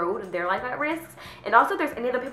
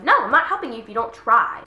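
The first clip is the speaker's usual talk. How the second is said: firm mock refusal; 3 semitones higher, 6 dB louder than usual. Repeats refusal to imagined moocher.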